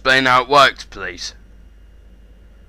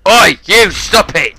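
loud warning yell; angry shout; 5 semitones higher, 6 dB louder than usual. Real, not acted. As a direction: order him to stop at full volume. Shouting at attacker.

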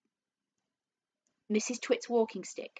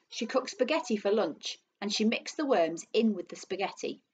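ordinary delivery, nothing marked

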